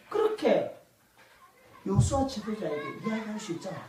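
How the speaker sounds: ordinary delivery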